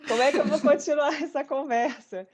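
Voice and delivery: overwhelmed self-quote broken by a laugh; moved, laughing. Retold or imitated. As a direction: laugh helplessly through the question. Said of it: "me emocionando, que eu tô assim".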